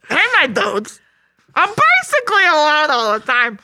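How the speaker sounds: high, goofy lonely coach; Coach Steve voice. Pretend. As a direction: sad dopey coach voice. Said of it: "my Big Mouth impressions".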